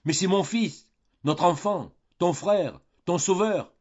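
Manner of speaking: high, loud desperate cry; a frantic father shouting to stop the quarrel. Acted. Old father begging his daughters to stop, high loud cry. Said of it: "criait le père Goriot".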